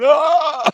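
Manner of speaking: loud, high imitated scream of pain; mock scream. Retelling a maced man, a loud high mock scream. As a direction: yell out like you've been maced. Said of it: "the bloke's, like, going".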